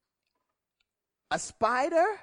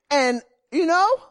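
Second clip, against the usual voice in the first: usual speaking voice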